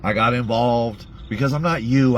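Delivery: usual speaking voice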